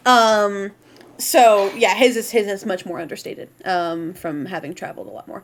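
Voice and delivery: normal talking voice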